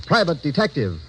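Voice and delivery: ordinary talk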